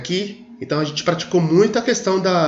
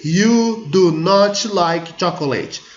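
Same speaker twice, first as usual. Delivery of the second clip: slow, loud, higher scolding, every word hit; scolding mother; 4 semitones higher, 4 dB louder, much slower than usual. Shown as a demonstration. Teacher showing how "do not" sounds like a scolding mother. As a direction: tell them off like an angry mom. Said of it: "Sabe quando a mãe vai briga com você?".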